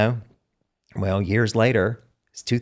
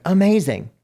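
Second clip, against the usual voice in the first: high, louder burst of retold enthusiasm; excited; 10 semitones higher, 5 dB louder than usual. Job seeker retells hearing about the salon, excited. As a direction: act out instant delight, pitch leaping.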